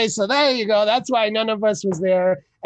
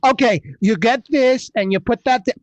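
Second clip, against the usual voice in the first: ordinary talk, nothing marked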